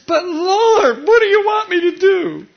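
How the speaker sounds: loud, high, tearful pleading; crying plea. Acted. Broken Saul begging the Lord for orders, tearful cry. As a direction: beg through tears.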